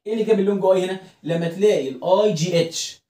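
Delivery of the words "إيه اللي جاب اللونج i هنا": ordinary delivery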